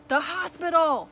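barked repeat. A narrator's reading. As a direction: bark it out insistently. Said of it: "Cap repeated".

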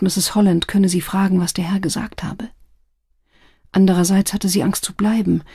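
usual speaking voice

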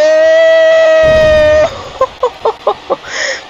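high shrieking laugh. Real, not acted. Falls off the cell.

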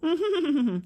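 loud, high jeering cackle; evil mocking laugh. Father laughs cruelly at his children, loud mocking cackle. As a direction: sneer at his family with a laugh.

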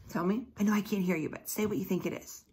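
plain reading voice

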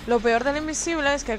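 ordinary talk, nothing marked

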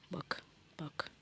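hushed whisper, no voice; sneaky whisper. Real, not acted. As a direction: whisper so the enemy won't hear.

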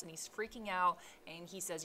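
plain reading voice

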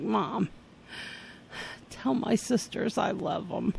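soft, feeble mock deathbed voice; drama queen's dying words. Daughter who thinks she is dying to mother, feeble farewell. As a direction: fade away theatrically, very quietly. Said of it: "I was a drama queen".

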